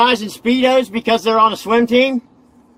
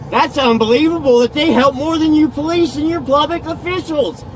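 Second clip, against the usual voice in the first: upset rant; 6 semitones higher than usual. Real, not acted. Upset man ranting at officers.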